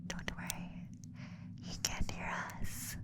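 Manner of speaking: voiceless conspiratorial whisper; hushed secret reassurance. Acted. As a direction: whisper it so the partner won't hear. Secret reassurance about the partner.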